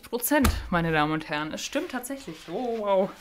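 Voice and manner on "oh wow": ordinary delivery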